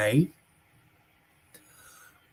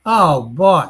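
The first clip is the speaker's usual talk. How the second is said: ordinary talk, nothing marked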